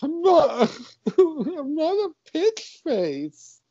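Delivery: high, slow hammed-up sniffle; mock whimper. Cop still upset, hammed-up tearful. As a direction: whimper it like a sulky child.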